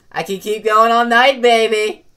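loud bragging. Acted. Showing off.

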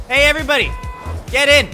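usual speaking voice

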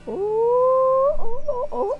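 long, high, loud "ooooh" breaking into short ohs; wailed "oh". Real, not acted. Player reacting to the game action, high loud wail.

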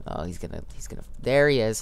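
usual speaking voice

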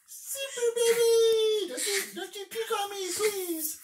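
very high, very slow, loud squeal, with a laugh breaking through; dad voicing his baby, pleading in a high squeal; squeaky baby voice. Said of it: "She's like".

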